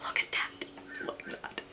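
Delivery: soft unvoiced whisper; awed whisper. Real, not acted. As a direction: hush in awe at the swatch. Showing swatch in whisper.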